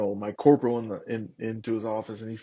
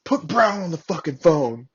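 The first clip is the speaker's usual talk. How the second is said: retold angry order, raised and louder; angry chewing-out; 6 semitones higher, 6 dB louder than usual. Superior demanding Brown over the phone, retold as an angry order. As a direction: imitate a superior tearing into someone. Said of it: "lighting his ass up".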